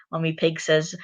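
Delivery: usual speaking voice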